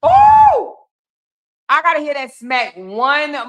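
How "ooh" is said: high loud "ooh" of shock. Real, not acted. Host rewatching the smack, high shocked ooh. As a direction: wince out loud at the hit.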